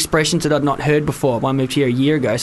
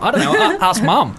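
sister's reply; 8 semitones higher than usual. Retold or imitated. Sister's retorted answer.

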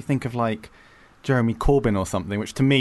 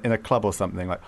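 ordinary talk

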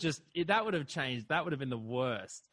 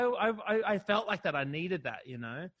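normal talking voice

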